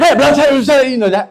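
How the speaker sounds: high, gabbled mock-Irish gibberish; mock Irish accent. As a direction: imitate an incomprehensible Irishman, high and loose. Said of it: "I met this Irish guy".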